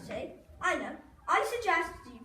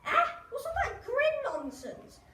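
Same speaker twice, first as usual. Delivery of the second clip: incredulous; 9 semitones higher, slower than usual. Acted. Dad baffled by new maths.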